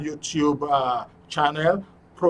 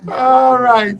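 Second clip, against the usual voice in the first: laughing; 9 semitones higher, 11 dB louder than usual. Real, not acted. Host recovering from laughing.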